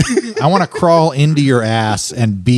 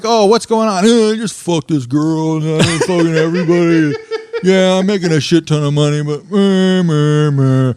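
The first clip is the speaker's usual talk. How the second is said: hammed-up, higher-pitched whiny imitation; mocking cohost's bragging; 3 semitones higher than usual. Comic mocking his cohost's life, whiny mock brag. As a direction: moan the brag like a put-upon friend. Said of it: "and then you".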